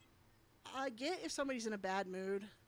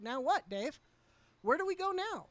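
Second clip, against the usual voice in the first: loud, high challenge flung at Dave; angry retort; 7 semitones higher, 6 dB louder than usual. Retold or imitated. Streamer confronts Dave in the Discord call, rising angry shout. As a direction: demand an answer, voice rising hard.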